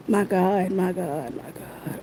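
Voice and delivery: fading to a whisper. Real, not acted. Overcome by the child's death.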